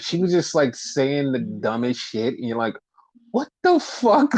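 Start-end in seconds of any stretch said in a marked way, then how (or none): 3.3-4.3 amused incredulity, pitched way up; baffled disbelief. Retold or imitated. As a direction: react to something absurdly random. Acts viewer's reaction to dumb remarks.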